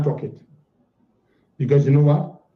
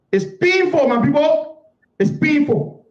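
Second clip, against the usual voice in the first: pained cry; 7 semitones higher than usual. Real, not acted. Pleading with supporters.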